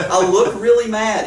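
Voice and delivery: incredulous echo. Retold or imitated. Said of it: "I was like".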